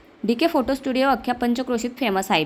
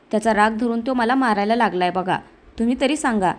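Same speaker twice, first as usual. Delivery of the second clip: plain reading voice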